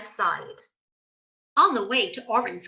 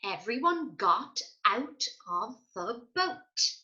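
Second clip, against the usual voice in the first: plain reading voice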